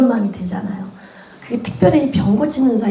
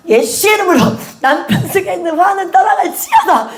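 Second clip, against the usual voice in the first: commanding prayer; 7 semitones higher than usual. Retold or imitated. Mock commanding prayer against anger.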